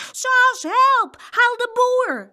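high, loud, panicked cry; shouting for help. A narrator's reading. Boy shouting to his sister for help, alarmed shout. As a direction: yell for help at a high pitch. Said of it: "riep Jeroen".

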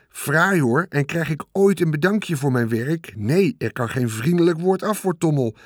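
a sulking rat fishing for thanks; aggrieved rat. Acted. The rat whining to Wilbur about never being thanked, sulky rat voice.

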